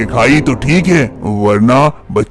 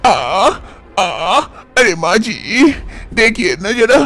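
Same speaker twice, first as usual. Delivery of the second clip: pained moan; 7 semitones higher, 4 dB quieter, slower than usual. Acted. Manisha in labor pain.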